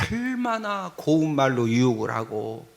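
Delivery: usual speaking voice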